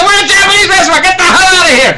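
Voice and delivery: high, loud mock brush-off from the Japanese restaurant; mock restaurant staff. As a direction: play the staff shooing pushy customers out, half laughing. Restaurant staff voiced throwing them out.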